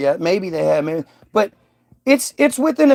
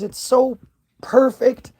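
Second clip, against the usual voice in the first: breathless whispered perfect; 5 semitones higher, slower than usual. Real, not acted. A father whispers how perfect his newborn was. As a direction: whisper it, overcome.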